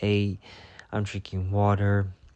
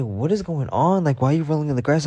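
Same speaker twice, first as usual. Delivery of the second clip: alarmed adults; 6 semitones higher, 5 dB louder than usual. Retold or imitated. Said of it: "they say".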